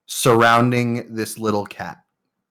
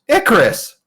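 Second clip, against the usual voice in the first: sharp call; 11 semitones higher, 4 dB louder than usual. Acted. Kid scolding Icarus.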